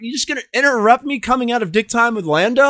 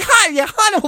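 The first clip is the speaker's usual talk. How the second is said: higher lady voice, sweet; lightly voiced housewife; man doing a woman's voice; 10 semitones higher than usual. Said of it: "why am I talking like the lady".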